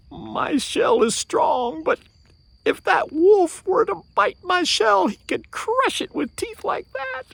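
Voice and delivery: high, slow worried voice; fearful thought. Acted. Turtle fears the wolf's teeth, high anxious voice. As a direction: fret over those big teeth.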